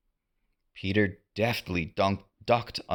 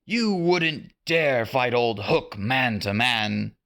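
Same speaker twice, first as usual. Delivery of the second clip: Hook jeering; 3 semitones higher, slower than usual. A narrator's reading. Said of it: "Captain Hook shouted".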